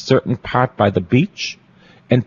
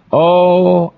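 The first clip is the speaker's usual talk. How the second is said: excited oh; 9 semitones higher, 5 dB louder than usual. Real, not acted. Excited exclamation in sermon.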